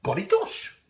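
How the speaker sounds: very high surprised exclamation; startled farmer. A narrator's reading. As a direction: jolt awake with a high cry. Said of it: "the farmer woke and said".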